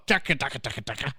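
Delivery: low, soft nonsense patter; mumbo-jumbo driver babble sinking away; mock Uber driver patter. Host retelling a Family Guy driver gag, low mock patter. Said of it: "and he's going".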